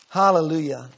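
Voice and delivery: usual speaking voice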